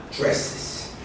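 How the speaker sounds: "dresses" said softly as dre-siz; "dresses", with an added iz; extra syllable. Shown as a demonstration. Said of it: "pronunciation changes with an extra syllable".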